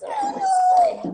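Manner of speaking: high loud laughing cry; laughing shout. Real, not acted. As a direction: burst out laughing loudly. Laughing outburst after blowing.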